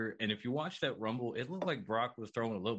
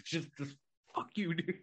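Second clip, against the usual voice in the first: ordinary delivery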